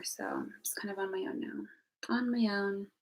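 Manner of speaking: soft unvoiced whisper, withdrawn; resigned whisper. Real, not acted. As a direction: whisper it to yourself, checked out.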